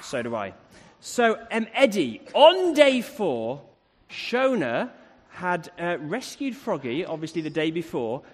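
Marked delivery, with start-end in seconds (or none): none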